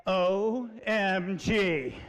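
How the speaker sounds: drawn-out comic disbelief; mock shock. Dad checking his daughter's school pictures, stunned. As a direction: spell it out very slowly, aghast.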